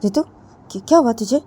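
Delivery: worried friend. Acted. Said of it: "Sneha वो रितु को बोलती".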